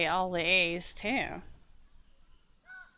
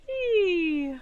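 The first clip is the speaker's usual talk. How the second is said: playful whee; 9 semitones higher, 4 dB louder than usual. Pretend. Playful camera ride.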